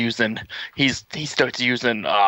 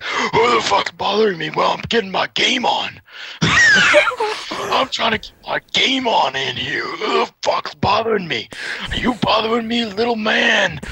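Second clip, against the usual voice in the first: high, loud mock tough-guy growl; big angry man; 10 semitones higher, 3 dB louder than usual. Big guy yelling at the kid who banged on his car, mock tough-guy shout. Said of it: "He's like".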